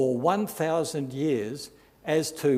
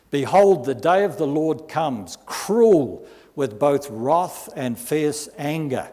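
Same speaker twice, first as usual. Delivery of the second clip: loud, slow, thundering prophecy; booming pulpit reading; preaching scripture; 6 dB louder than usual. Acted. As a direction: proclaim it like a prophet's warning. Reads prophecy from Isaiah.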